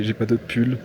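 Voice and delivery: usual speaking voice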